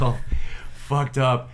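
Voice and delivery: ordinary talk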